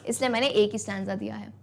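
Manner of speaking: ordinary talk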